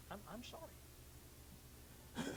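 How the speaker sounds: breathy, barely voiced "I'm sorry"; hushed apology. Pleading with the one he offended, whispered. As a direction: let the apology fade to a whisper.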